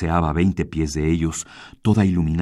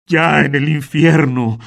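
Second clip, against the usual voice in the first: higher, louder, slow cry of torment; anguished cry; 6 semitones higher, 5 dB louder, slower than usual. Acted. Martínez crying out in terror as he flees, loud and slow. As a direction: wail it out like a damned man. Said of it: "grita Martínez".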